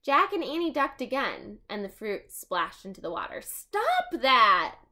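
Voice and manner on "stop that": a loud, firm call; shouted. Acted. Annie telling the monkey to stop, loud call. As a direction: snap it at the monkey. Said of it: "Annie shouted".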